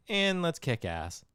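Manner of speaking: slow, self-assured mock challenge; cocky action heroine. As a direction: relish it slowly like a confident heroine. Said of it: "and then she's like".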